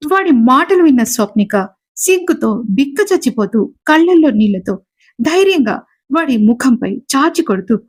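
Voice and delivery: usual speaking voice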